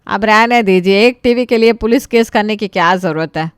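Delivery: father brushing off the police idea; father's voice. Acted. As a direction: wave it off like a tired father.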